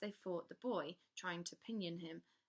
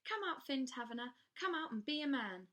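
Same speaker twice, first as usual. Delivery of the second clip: higher, louder commanding call; loud and clear; 7 semitones higher, 5 dB louder than usual. Acted. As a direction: call him up with authority. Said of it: "she said loudly and clearly".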